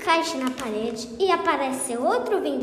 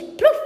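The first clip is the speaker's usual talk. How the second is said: loud splash; 13 semitones higher, 7 dB louder than usual. Acted. Patão falls into bathroom.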